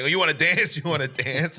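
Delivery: high, loud, half-laughing goading; retold bullying taunt. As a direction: imitate a crazy bully egging his victim on. Retold bully taunting while shooting.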